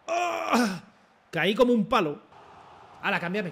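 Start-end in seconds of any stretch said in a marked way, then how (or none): 0.1-0.8 high, drawn-out "ah"; pained cry. Real, not acted. Player falling on the pitch, high cry. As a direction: cry out as if knocked over. Cries out as he falls.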